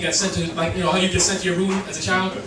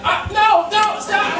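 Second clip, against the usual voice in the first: higher, louder panicked dog protest; a hammed-up yelp of a dog begging to stop; 10 semitones higher, 4 dB louder, much slower than usual. The dog begging his owner to stop, higher and hammed-up. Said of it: "he's like".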